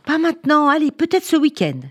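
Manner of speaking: busy father's brush-off. A narrator's reading. Busy father postponing until the weekend, dismissive. Father putting daughter off once more.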